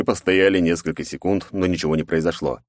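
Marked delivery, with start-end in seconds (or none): none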